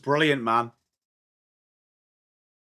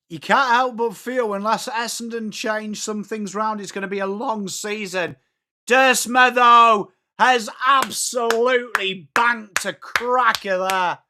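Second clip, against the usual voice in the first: raised commentator voice building to a loud call; live play-by-play; 8 semitones higher, 4 dB louder than usual. Acted. Streamer commentating on Essendon's season and a goal, excited play-by-play. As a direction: commentate earnestly, then punch out the goal.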